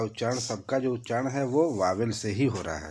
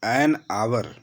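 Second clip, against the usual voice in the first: usual speaking voice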